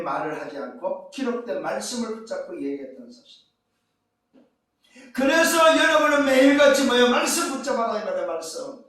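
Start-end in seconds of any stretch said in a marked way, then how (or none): 5.1-8.0 forceful urging. Real, not acted. Urging the congregation.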